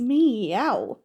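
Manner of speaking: drawn-out cat call; playful meow. Pretend. Co-host greets fellow cat fan, playful meow.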